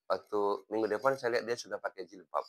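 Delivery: normal talking voice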